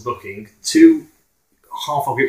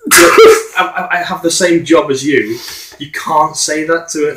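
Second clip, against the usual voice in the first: indignant retort; 5 semitones higher, 8 dB louder, slower than usual. Retold or imitated. Arguing with the manager.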